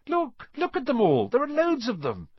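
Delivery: high, eager urging; bursting to show a friend; excited. A narrator's reading. Harry urges Ron to look.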